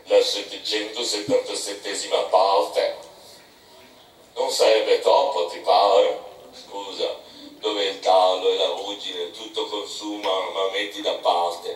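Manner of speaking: put-on a miserly old captain haggling low and slow; old Bildad. A narrator's reading. Bildad voiced by narrator.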